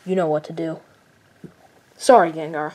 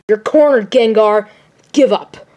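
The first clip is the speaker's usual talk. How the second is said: normal talking voice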